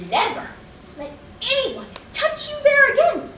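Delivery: mock scolding from a mother, rising high after a firm pause; angry mama. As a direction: scold a child in separate, emphatic bursts. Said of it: "she gets mad at me and yells".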